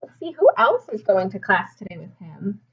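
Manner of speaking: ordinary delivery, nothing marked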